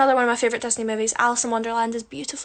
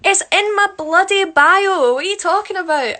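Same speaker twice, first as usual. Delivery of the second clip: exasperated; 7 semitones higher, 7 dB louder than usual. Real, not acted. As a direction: throw up your hands, it's in the bio. Exasperated about name mispronunciation.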